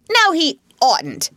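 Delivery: high, loud contradiction; shouting. A narrator's reading. Mole cuts in on Rat, shouting. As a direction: shout him down. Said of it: "shouted the Mole, with his mouth full".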